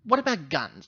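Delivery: usual speaking voice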